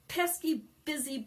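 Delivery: usual speaking voice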